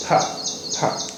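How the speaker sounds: फा said with a soft f, lips and teeth; f sound demo. Shown as a demonstration. Teacher showing the f sound फा to students. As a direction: breathe out a light f in फा, not a p. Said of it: "तो उनमें से एक sound है ये".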